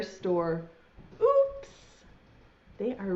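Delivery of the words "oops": high squeaky oops of surprise; sudden high cartoon oops; surprised oops. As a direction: yelp it out like a startled cartoon animal. Animals crash into paint.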